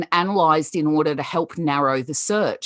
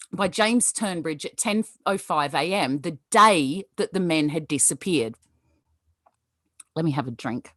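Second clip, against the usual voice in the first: ordinary talk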